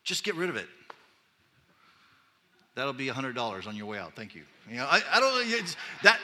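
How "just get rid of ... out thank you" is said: Paul as a dismissive counselor, lightly voiced; flippant counselor. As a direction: cut them off and wave it away. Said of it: "And Paul's like".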